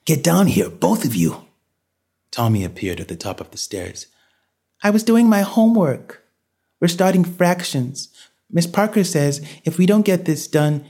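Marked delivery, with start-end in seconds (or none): 0.0-1.5 urgent fatherly command, starting high; barked order. A narrator's reading. Father orders both twins down, barking. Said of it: "Ed barked". 4.8-10.8 put-on high-pitched child's voice making excuses; protesting young boy. Son protests to father about homework, high boyish voice.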